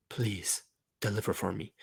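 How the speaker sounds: very soft, low begging; quiet, intimate entreaty; hushed plea. Acted. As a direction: plead almost in a whisper.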